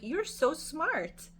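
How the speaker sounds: high, very slow gushing praise; admiring praise. Retold or imitated. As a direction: draw out the compliment slowly. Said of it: "I looked at her and I'm like".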